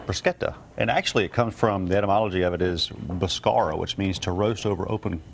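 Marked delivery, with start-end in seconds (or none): 0.1-0.5 "Bruschetta" with a hard sk, higher pitched; Italian pronunciation. Shown as a demonstration. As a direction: model "Bruschetta" as broo-SKET-uh. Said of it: "in Italy it's pronounced".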